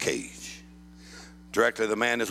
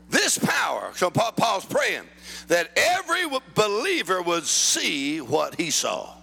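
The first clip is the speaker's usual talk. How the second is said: forceful sermon with leaning stresses; emphatic preaching; 9 semitones higher, slower than usual. Real, not acted. Preacher urges congregation to pray Paul's prayers, emphatic preaching. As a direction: press each phrase home to the crowd.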